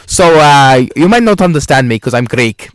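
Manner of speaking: loud, laughing mock Greek accent; hammed-up Greek accent, loud and amused. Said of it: "imagine him in the next room going".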